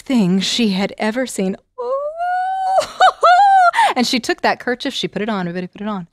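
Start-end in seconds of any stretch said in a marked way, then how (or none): 1.8-3.9 very high squeal of delight; delighted ooh. Acted. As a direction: coo over the gift. Maid admires kerchief.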